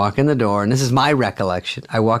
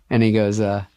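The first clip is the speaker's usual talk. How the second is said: usual speaking voice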